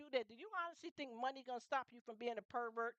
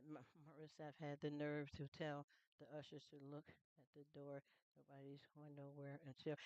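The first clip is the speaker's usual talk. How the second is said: much lower and softer, a heavy imitated man's voice; retold pastor's voice; 7 semitones lower, 8 dB quieter than usual. Quoting the pastor who called her name in church, low imitated voice. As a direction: drop low and quiet to play him.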